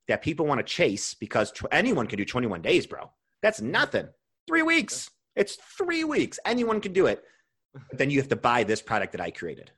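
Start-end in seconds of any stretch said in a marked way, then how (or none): 3.4-7.2 much higher, dismissive sales patter; a diet guru's mocking hype; mock salesman pitch. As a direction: mimic a huckster brushing it off.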